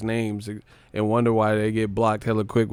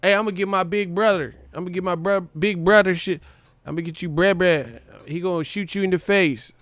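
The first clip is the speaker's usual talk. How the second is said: higher, fast, bragging threat; mock tough guy; 6 semitones higher, faster than usual. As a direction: play the kid calling his big brother, fast and high. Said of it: "you on the set and shit".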